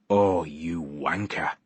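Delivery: slow, sighed-out exasperation; weary sigh. A narrator's reading. Man realizes his friend pranked him, slow and sighing. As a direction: let the breath carry a defeated groan. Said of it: "I sighed".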